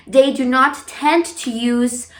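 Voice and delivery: ordinary talk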